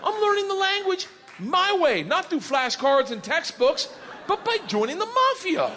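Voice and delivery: high, excited bragging; thrilled. Retold or imitated. Excited at understanding Russian.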